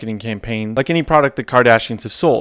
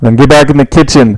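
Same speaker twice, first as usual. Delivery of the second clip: loud retort; 12 dB louder than usual. Acted. Mock retort to AOC clip.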